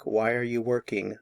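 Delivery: normal talking voice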